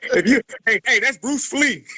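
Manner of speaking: laughing tease. Real, not acted. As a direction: crack up while naming the lookalike. Laughing at the man's name joke.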